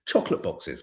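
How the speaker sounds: ordinary delivery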